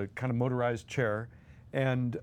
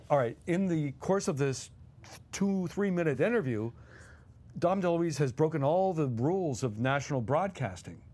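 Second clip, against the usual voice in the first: ordinary delivery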